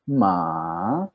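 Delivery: "ma" drawn out, sinking low then climbing back; dipping tone on ma. Shown as a demonstration. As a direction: dip "ma" right down and swing it up at the end. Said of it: "dibacanya dari atas, turun ke bawah, naik lagi".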